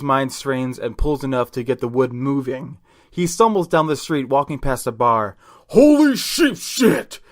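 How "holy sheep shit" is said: put-on high, loud, drawn-out drunken yell; startled drunk. Lonely drunk spots demonic guillotine, shocked yell. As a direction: exclaim like a drunk seeing something unbelievable. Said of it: "a lonely drunk said to himself".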